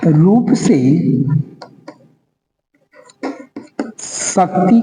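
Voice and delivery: word by word with very long pauses; slow dictation. Real, not acted. Teacher dictating a scholar's definition to students, slow dictation. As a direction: dictate slowly for people writing it down.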